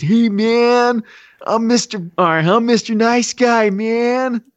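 high, drawn-out fake Jamaican; mock Jamaican accent. [name] pretending to be Mister Nice Guy, mock Jamaican accent. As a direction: do a hammed-up Jamaican stoner. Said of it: "if it actually was [name] while being Jamaican".

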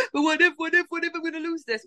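imitating a frightened actor's what-ifs; mock panic. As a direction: play the anxious worrier, voice climbing. Voices a panicked creative person.

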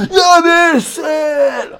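high, slow, drawn-out mock scream; toddler tantrum scream. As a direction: wail it like a furious toddler. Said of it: "begynder han bare at skrige".

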